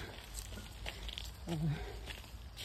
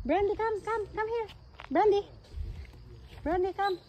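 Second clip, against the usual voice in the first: high, coaxing pet call; calling the dog; 9 semitones higher, 5 dB quieter than usual. Real, not acted. Calling her dog to come.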